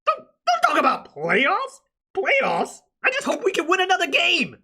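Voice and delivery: hammed-up voice-crack rant; voice cracking high. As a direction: squeal it in disbelief. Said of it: "his fucking voice cracking".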